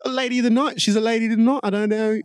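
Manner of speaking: British accent mocking the cops. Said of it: "They always say that".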